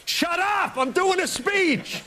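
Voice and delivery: high, exasperated mock yell; Bush snapping at ghost. Bush mid-speech yelling at invisible heckler, high mock yell. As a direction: yell back at the voice in your head. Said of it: "I expect him at some point to go like".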